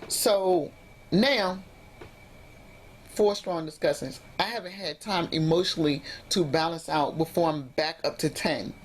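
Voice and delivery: ordinary talk, nothing marked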